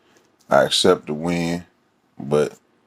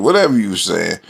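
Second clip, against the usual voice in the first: ordinary talk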